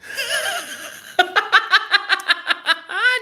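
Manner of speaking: high, long giggling fit; joking laugh. Real, not acted. Laughing at own joke.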